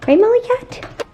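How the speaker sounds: very high, loud cooing to a cat; doting high-pitched cat voice; pet talk. Real, not acted. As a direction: call to your kitty in a squeaky sing-song. Talks to her cat.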